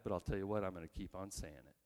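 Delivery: quiet resolve. Acted. Resolve about coming death.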